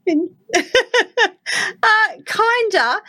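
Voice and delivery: high, laughing through the words; laughing half-agreement. Real, not acted. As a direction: giggle out a grudging kinda. Cohost laughing at joke.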